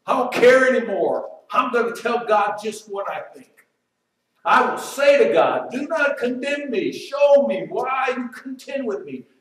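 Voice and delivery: loud, bitter complaint to God; acting out bitter Job. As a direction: shout out Job's anger at God. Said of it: "Now he's saying".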